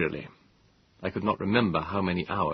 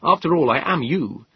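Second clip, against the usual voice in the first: rising anger; 7 semitones higher, 5 dB louder than usual. Acted. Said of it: "I said in rising anger".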